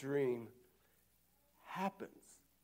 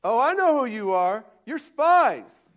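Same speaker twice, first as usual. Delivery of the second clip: much louder and higher, accusing; accusing Joseph; 8 semitones higher, 11 dB louder than usual. Retold or imitated. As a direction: hurl the spy charge at the brothers. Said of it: "And Joseph said to them".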